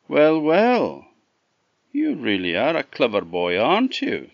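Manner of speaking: a hint of a grandly impressed host; lightly voiced Green Man. Green Man greets clever Chuck with the ring, mock admiration.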